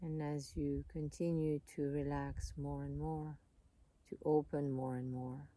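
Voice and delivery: calm, unhurried voice with long pauses; soothing meditation guide, leaving space between phrases; guided meditation. Real, not acted.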